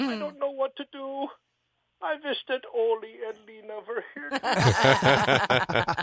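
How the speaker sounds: very high, slow whimpering voice; crying Sven. Acted. Sven lost alone in the snow with the genie, very high slow whimper. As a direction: whimper it out like a freezing, crying man. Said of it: "he starts to cry".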